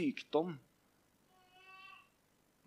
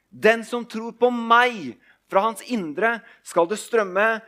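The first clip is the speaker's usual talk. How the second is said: Jesus crying out; 8 semitones higher, 10 dB louder than usual. Acted. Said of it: "Jesus står fram og roper". (Jesus roper til folket, høyt og langsomt)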